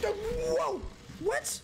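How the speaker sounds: high, shocked yelp; startled. Real, not acted. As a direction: cry out as if suddenly grabbed. Grabbed by a monster.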